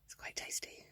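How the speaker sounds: soft whispered aside. Real, not acted. Owner whispering to chickens over the food. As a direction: breathe it to the birds.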